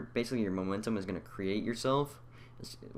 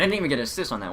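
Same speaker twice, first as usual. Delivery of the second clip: louder annoyed griping; loud complaint; 6 semitones higher, 9 dB louder, much faster than usual. Real, not acted. Gamer to viewers, loud annoyed complaint. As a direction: gripe loudly at the game. Complaining about missed assist.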